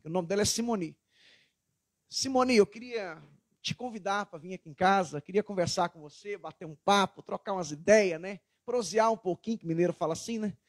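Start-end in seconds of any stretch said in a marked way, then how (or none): 2.1-9.6 softer, easygoing invitation; laid-back friendly chat tone; relaxed and friendly. Retold or imitated. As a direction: invite her gently, all calm. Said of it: "Ela vai entender que eu tô de boa".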